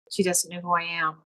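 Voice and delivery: ordinary delivery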